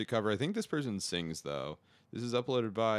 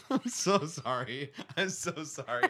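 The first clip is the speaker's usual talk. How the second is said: laughing; 8 semitones higher, slower than usual. Real, not acted. Laughing apology.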